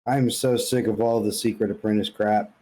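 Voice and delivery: normal talking voice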